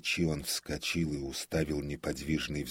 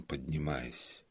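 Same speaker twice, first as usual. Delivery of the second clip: usual speaking voice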